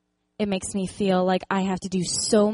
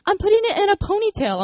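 put-on much higher, louder child's voice; little girl explaining; 13 semitones higher, 6 dB louder than usual. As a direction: explain it matter-of-factly like a small girl. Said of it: "she's like".

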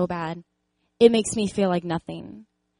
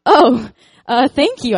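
laughing, much louder embarrassed thanks; flustered laughing thanks; 5 semitones higher, 12 dB louder, slower than usual. Retold or imitated. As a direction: thank her while laughing, caught off guard. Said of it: "And I'm like".

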